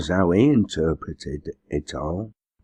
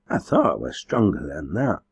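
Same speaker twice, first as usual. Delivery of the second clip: ordinary delivery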